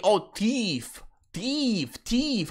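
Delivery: thief as a high, falling "teef", repeated; mock the way thief gets said as "teef"; mimicked mispronunciation. Streamer echoing what he is told he keeps saying, thief as mimicked "teef". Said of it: "What do you mean you keep saying".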